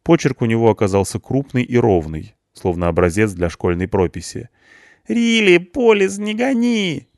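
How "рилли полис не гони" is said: very high, panicky punk voice; a scared young punk begging; panicky whine. A narrator's reading. Punk panics behind bars to the policeman, high whine. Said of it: "панически отозвался".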